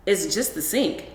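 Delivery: ordinary delivery, nothing marked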